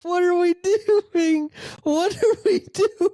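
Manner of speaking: very high, loud, drawn-out squeal; helpless incredulous laughter. Real, not acted. Host losing it over the team's scoring average, laughing squeal of disbelief. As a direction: wail it slowly through real laughter.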